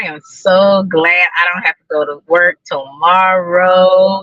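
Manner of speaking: ordinary talk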